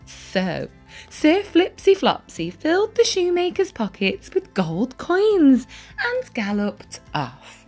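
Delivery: plain reading voice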